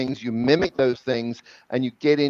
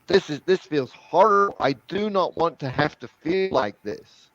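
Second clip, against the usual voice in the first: higher-pitched, emphatic complaint; acted distress; 5 semitones higher than usual. French student objecting to his coach's face changes, acted distress. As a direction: push back in retold dismay. Retold French student refusing the new mouth position.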